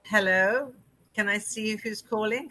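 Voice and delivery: ordinary talk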